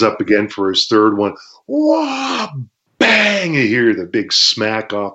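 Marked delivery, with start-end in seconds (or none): 1.7-2.5 much higher stretched whoa; imitating the opponent winding up; wind-up yell. Wrestler mimicking opponent's kick wind-up, high yell. 3.0-3.5 high punchy bang; impact sound. Retold or imitated. Wrestler acting out the third kick, punchy bang.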